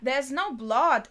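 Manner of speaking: ordinary talk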